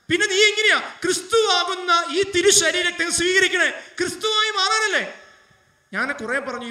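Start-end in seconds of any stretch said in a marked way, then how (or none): 0.1-5.0 put-on a loud, high, shouted challenge to the girl; forceful rebuke. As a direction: fire the question at her. Priest retells rebuking the girl.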